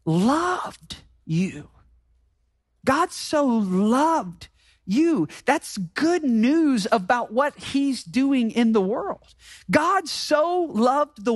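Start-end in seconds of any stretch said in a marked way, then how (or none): 0.1-5.3 tender emphasis. Acted.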